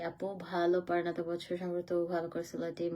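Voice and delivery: usual speaking voice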